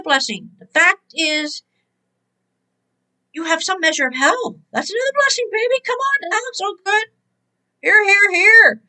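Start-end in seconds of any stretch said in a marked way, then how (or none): 4.7-7.0 high, rising praise; 목소리를 높여 신나게 외치는 설교; excited preaching. Real, not acted. Preacher exclaims. 7.8-8.8 calling out. Real, not acted. Preacher calling out.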